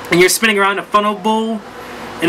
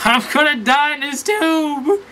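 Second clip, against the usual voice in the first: much higher, slower, laughing through the words; laughing mock panic; 8 semitones higher, slower than usual. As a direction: laugh at your own fake doom. Panicking in the water slide.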